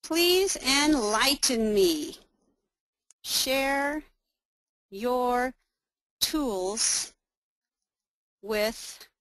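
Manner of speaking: drawn-out words, each set apart; very slow, with gaps between words; slow typing dictation. Real, not acted. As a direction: speak word by word at typing speed. Reading aloud while typing dialogue.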